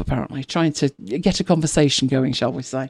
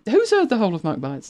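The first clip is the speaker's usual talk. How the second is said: ordinary delivery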